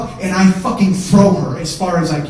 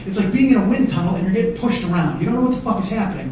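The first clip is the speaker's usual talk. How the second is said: normal talking voice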